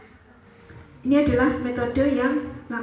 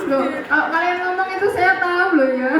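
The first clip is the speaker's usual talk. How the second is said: higher, louder, through laughter; laughing; 5 semitones higher, 5 dB louder than usual. Real, not acted. Lecturer to students about their slang, laughing. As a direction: tease them while cracking up.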